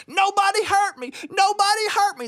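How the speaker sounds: very high-pitched acted holler; storyteller's high yell of a hurt giant. A narrator's reading. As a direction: wail it out high, over and over. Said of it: "And he kept a yelling".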